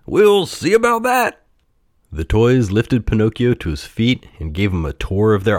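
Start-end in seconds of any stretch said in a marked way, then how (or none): 0.1-1.4 high, challenging clown voice; scoffing clown. A narrator's reading. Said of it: "scoffed a clown".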